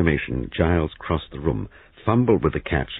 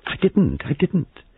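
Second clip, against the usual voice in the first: high, helpless protest; 13 semitones higher, slower than usual. A narrator's reading. Frightened hostess denying she called police, helpless. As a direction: plead it in a much higher voice. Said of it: "She said helplessly".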